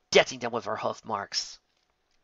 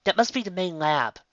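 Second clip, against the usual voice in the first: voiced Twilight; 7 semitones higher, 4 dB louder than usual. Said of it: "Twilight said".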